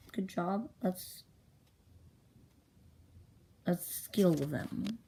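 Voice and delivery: slow, hesitant, questioning delivery; halting reading. Acted. Storyteller struggles to read own handwriting, slow halting reading. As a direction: feel your way through the words, drawing them out.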